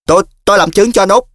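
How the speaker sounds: high, fast, blurted-out butting in; heated interruption. Acted. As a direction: cut in hotly and quickly. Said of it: "Tư Lùn tức khí xen vô".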